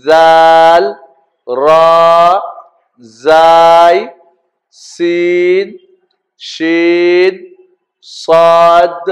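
drawn-out, level-pitched letter names; alphabet recitation. Shown as a demonstration. Teacher leading learners through the Arabic alphabet, measured recitation. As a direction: hold each Arabic letter name on a steady note.

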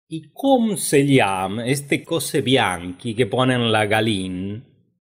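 mock Frenchified accent, drawn-out ending; hammed-up foreign-accented Spanish; affected foreign accent. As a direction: imitate a fool back from Europe with a put-on foreign accent. Mocking a Europeanized fool.

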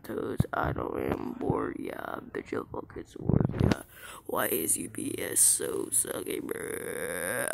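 low, creaky drone; goofy slow croak, barely voiced; cringy put-on voice. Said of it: "that's just me being cringy".

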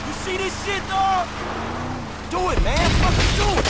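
excited urging. Acted. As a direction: egg him on wildly. Urging friend during chaos.

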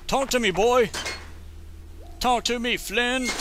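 high-pitched, playful plea for a response; mock-urgent, pitched well up; urgent calling out. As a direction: call out to a character who won't answer. Calling out to game character.

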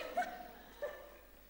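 a shrill burst of mocking laughter; high mocking cackle. Aunt laughing at the girl over Francek, high cackle. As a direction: cackle at the girl's mention of Francek.

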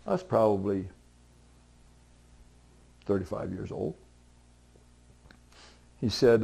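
ordinary delivery

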